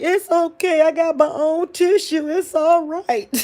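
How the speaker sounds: high, loud, bright reassurance to the doctor; telling the doctor it's fine between tears; reassuring her doctor. Retold or imitated. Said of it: "I be like".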